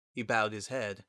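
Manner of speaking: ordinary delivery, nothing marked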